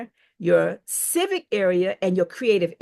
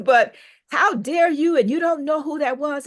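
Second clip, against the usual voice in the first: mock indignant scolding; 7 semitones higher, 3 dB louder than usual. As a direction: scold her like an upset friend, lightly. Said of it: "my group is really upset".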